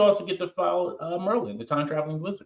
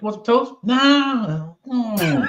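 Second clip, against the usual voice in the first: mom and whiny reply; 8 semitones higher, 4 dB louder, much slower than usual. Retold or imitated. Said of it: "she's like".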